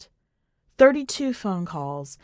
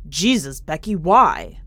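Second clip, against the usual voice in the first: louder, slowed alarmed cry; alarmed outburst; 4 semitones lower, 6 dB louder, slower than usual. Acted. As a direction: blurt it out in shock as the bowl hits the floor. Candy bowl clatters, sudden alarm.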